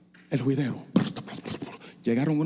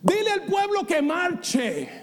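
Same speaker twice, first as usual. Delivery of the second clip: high, slow ringing proclamation; proclaiming; slower than usual. Acted. Preacher proclaiming God's command to march, slow and high. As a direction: call the people forward.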